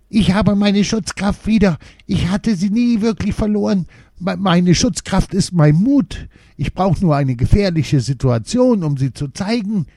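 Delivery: high, loud excited dwarf voice; bursting with joy at a discovery. A narrator's reading. Little dwarf tells the children he found his courage, excited and high. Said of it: "rief Jona ganz aufgeregt".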